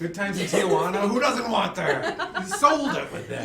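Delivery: high, loud and laughing; excited. Real, not acted. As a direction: cheer the line on. Excited praise of the pitch.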